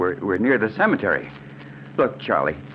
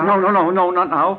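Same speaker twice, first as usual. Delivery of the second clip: higher, louder frantic no's; terrified refusal; 7 semitones higher, 4 dB louder than usual. Acted. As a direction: beg in terror. Victim refuses immediate death.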